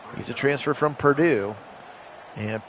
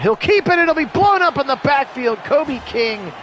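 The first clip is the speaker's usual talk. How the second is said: much higher and louder, near a shout; excited play call; 8 semitones higher, 6 dB louder than usual. Acted. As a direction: yell it as the play collapses. Play blown up in backfield.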